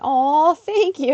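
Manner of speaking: touched. Real, not acted. Thanking a kind viewer.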